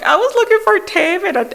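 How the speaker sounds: wife squeaking out an embarrassing confession; embarrassed confession. Retold or imitated. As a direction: squeak it out sheepishly. Confessing sheepishly to husband.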